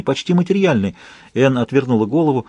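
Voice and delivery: usual speaking voice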